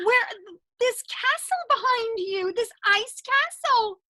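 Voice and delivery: high-pitched, marvelling at the sight; wide-eyed kids' host awe; awed wonder. Pretend. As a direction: gasp it out in amazement. Host marvels at Elsa's castle.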